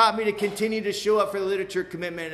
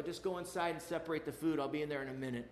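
calm instruction; 2 semitones lower, 9 dB quieter, faster than usual. Retold or imitated. Calming son during the crisis.

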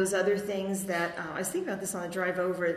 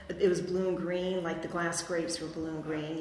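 ordinary talk

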